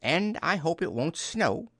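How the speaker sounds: high, cheery add-on; light, upbeat character voice; cheerful. A narrator's reading. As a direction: toss it in cheerfully. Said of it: "said Rabbit cheerfully".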